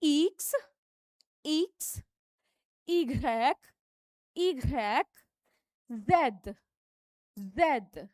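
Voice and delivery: French letters said crisply; teacher drilling French letters; French letter names. Shown as a demonstration. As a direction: model each French letter for students. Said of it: "फिर से repeat करेंगे".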